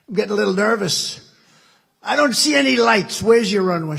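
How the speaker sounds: hammed-up nerves about no runway; mock nervous. President to the captain during descent, mock nervous.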